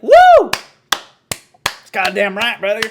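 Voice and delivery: loud, high whooping cheer mocking his fans. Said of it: "they're already like".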